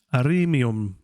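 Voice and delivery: ordinary delivery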